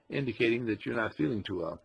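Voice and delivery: ordinary delivery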